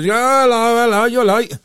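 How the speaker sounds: high, loud put-on friendliness hiding annoyance; fake cheerful greeting. Greeting a late friend at the pub, falsely cheery. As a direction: sing out hello through clenched annoyance. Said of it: "It's inwardly seething".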